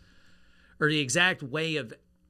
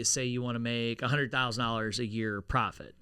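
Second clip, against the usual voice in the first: usual speaking voice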